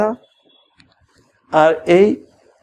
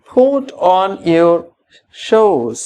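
slow model phrase; much slower than usual. Shown as a demonstration. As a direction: put on your shoes said very slowly, word by word. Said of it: "slow model phrase for repeating".

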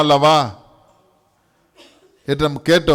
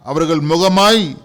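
ordinary talk, nothing marked

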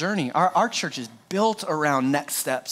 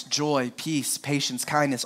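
normal talking voice

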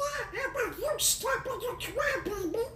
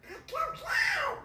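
panicked yell; 8 semitones higher than usual. Acted. Donald pressed about missing remote.

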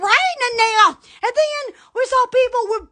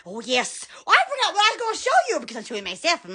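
ordinary delivery

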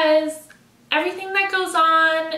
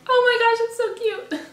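gushing squeal; 8 semitones higher, faster than usual. Retold or imitated. Reader gushing at the ending, high delighted squeal. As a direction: gush over how cute it is. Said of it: "and I was like".

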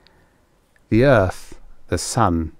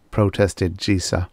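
usual speaking voice

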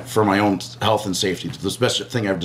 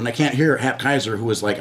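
usual speaking voice

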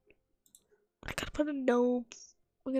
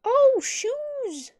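high, loud delighted "Oh, shoes!"; excited discovery; 11 semitones higher, 8 dB louder than usual. Real, not acted. Spotting shoes among the furniture options, thrilled. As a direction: burst out at the find.

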